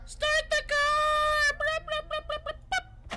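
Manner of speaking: very high, slow squealed nonsense; mock high squeal. Player mocking the game, squealed high nonsense.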